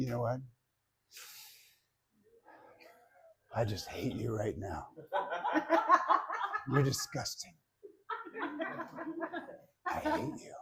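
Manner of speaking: soft, hushed and loving, nearly a whisper; tender hushed I hate you. Shown as a demonstration. Teacher demos a loving tone to seminar students, hushed and tender. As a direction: say I hate you like a sweet secret. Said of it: "you see the difference?".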